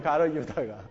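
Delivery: ordinary talk, nothing marked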